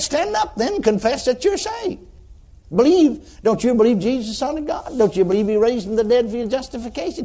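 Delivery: high-pitched retold exhortation; insistent urging. Preacher urging sinner to confess, high and insistent.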